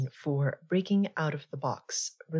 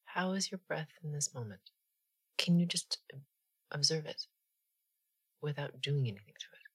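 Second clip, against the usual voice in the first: guided meditation; 3 dB quieter than usual. Acted. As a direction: ease them gently into stillness, unhurried.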